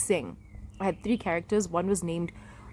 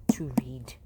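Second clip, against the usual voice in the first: whispering; 7 semitones lower, 5 dB quieter than usual. Real, not acted. Whispered line.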